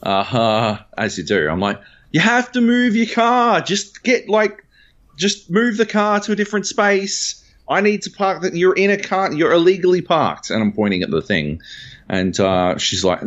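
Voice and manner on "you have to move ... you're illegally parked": high-pitched raised voice, insistent; yelling. Retold or imitated. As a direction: yell it louder so she hears. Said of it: "I start yelling louder".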